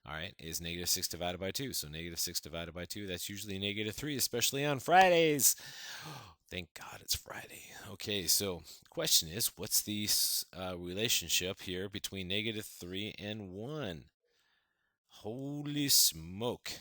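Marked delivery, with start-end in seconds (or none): 4.1-5.6 much higher and louder, playful; excited joke. Real, not acted. Joke about Friday excitement. 5.6-6.4 a sharp quick intake of breath; mock gasp. Real, not acted. Mock gasp before joke. 6.5-7.6 unvoiced, secret aside; whisper. Real, not acted. 15.1-16.7 higher, louder and very slow; amazed exclamation. Real, not acted. Teacher marvels.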